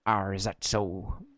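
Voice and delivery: slow, drawn-out imitation of own peddler line; mock peddler voice. Player mocks his own peddler voice, slow and drawn out. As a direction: stretch the "ah" like a dithering old man. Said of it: "I've given him, like".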